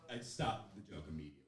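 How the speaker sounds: ordinary talk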